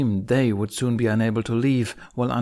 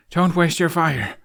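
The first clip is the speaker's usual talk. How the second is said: slow, strained, higher grunt mid-strike; grunted with effort; 7 semitones higher, 5 dB louder than usual. Acted. Hiccup to Toothless mid-fight, strained grunt. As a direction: push the words out while swinging hard. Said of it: "Hiccup grunted with the effort".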